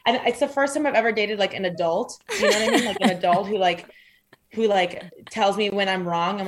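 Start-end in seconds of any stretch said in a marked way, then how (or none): none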